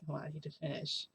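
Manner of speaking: very soft, near-whispered plea; hushed coaxing. Retold or imitated. Toshinori urges her while visitor waits outside, hushed coaxing. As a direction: coax under your breath while hiding.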